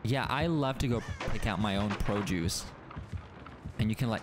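ordinary talk, nothing marked

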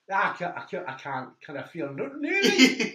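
loud, high Geordie dad; Geordie accent. Retold or imitated. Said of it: "if you imagine this in a Geordie accent".

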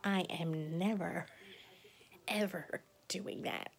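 put-on slow, firm childish vow; stubborn refusal. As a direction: plant your feet and refuse like a kid. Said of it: "I remember distinctly saying".

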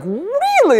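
very high imitated squeal of disbelief; hammed-up incredulous kid; amazed son. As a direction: mimic a boy's voice shooting up in astonishment. Imitates son, even more amazed.

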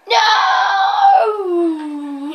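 high, loud, long wail of a no; loud drawn-out no. Real, not acted. Gamer crying out mid-game, loud drawn-out no. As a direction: wail a long NO, falling away.

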